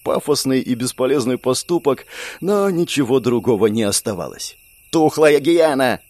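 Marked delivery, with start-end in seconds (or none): 4.9-6.0 slow, loud furious yell; angry shout. A narrator's reading. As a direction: spit out the insult. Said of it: "выкрикнула Магесса". (злобный выкрик)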